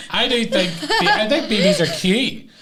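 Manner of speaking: giggly backtracking; laughing. Real, not acted. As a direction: protest through real laughter. Giggling while backtracking on ugly babies.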